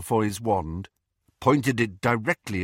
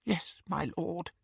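soft, devoted whisper; 9 semitones higher, 7 dB quieter than usual. Acted. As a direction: breathe it with adoring gratitude. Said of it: "whispered Bellatrix".

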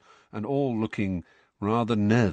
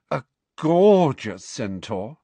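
slow, higher, sighing with longing; dreamy sigh; 4 semitones higher, 4 dB louder, slower than usual. A narrator's reading. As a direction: swoon over him as you say it. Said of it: "sighed Parvati".

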